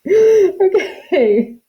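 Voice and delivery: high, loud laughing okay; amused at yourself, laughing it out. Real, not acted. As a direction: laugh your way into the word. Laughs at own whispering slip.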